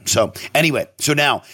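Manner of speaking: ordinary delivery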